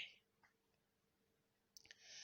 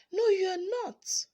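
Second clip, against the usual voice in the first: sobbing; 8 semitones higher, 4 dB louder than usual. A narrator's reading. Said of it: "Sally sobbed".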